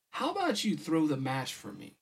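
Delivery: ordinary delivery, nothing marked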